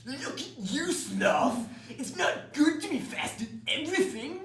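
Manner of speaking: high shrill goose yell, shouting up at the sloth. A narrator's reading. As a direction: squawk it up at him like a mocking goose. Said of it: "the goose yelled up at the sloth".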